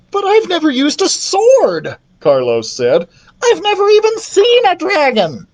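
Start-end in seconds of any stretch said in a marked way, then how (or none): 0.1-2.0 put-on high-pitched boyish panic; alarmed protest. Carlos objects to slaying dragons, alarmed. 3.4-5.5 very high, panicky wail; alarmed protest. Acted. Carlos objects to slaying dragons, panicky.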